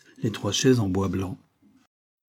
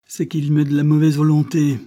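loud, raised, indignant retort; angry accusation; 6 semitones higher, 7 dB louder than usual. A narrator's reading. Banquiste answers Bourbonnaise, blaming child, angry retort. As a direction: blame the child roughly.

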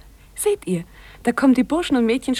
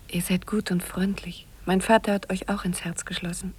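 tender whisper; 6 dB quieter than usual. Acted. Gertrud speaks tenderly to Arnold.

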